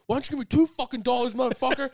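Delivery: high, loud mock-angry shout; aggressive demand. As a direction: imitate an irate panhandler yelling. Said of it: "somebody tried to fucking attack me".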